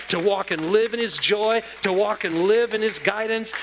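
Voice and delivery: preacher's chant growing stronger; building litany. Acted. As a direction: build each line up.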